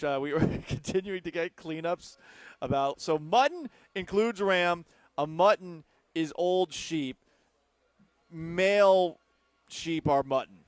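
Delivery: usual speaking voice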